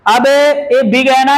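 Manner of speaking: normal talking voice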